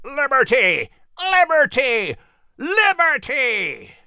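high, far-carrying bird cry; last Liberty slow and drawn out; eagle crying out. Acted. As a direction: screech it like an eagle overhead. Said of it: "crying out".

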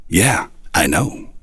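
soft, slow smiling answer; smiling reply. Retold or imitated. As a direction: shrug it off with a smile. Reply with a smile.

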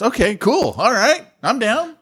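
mock agreeable surprise. Host mocks own reaction to pastors asking for prayer, mock agreeable surprise. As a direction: act out a high, tentative okay. Acts out surprised inner reply.

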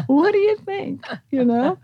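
high, playful knowing question; teasing. Retold or imitated. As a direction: tease with a lifted voice.

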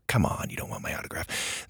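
bashful whisper under the breath; shy whispered aside. Real, not acted. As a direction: whisper it modestly to yourself. Modest aside to the reviewer.

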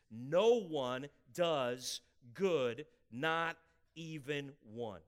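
slow, building to a loud peak on "No one does good"; solemn slow build to a loud cry; slow scripture preaching. Acted. Reads scripture, building to climax.

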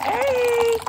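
high, loud, sing-song hey; excited hey. Real, not acted. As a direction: cheer it out like greeting a friend. Greeting the mug, far, high.

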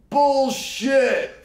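high loud heckle; shouted disbelief. Retold or imitated. Heckler at the back calling out in disbelief. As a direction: yell it from the back, scoffing. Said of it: "you hear".

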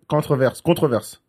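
"controverse" said as a French word; French pronunciation. Shown as a demonstration. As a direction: give "controverse" a French accent. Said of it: "I can say it in French".

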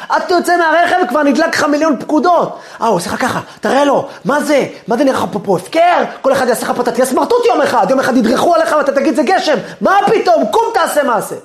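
an inner voice egging you into a fight; heated rant that keeps building; worked-up inner voice. Pretend.

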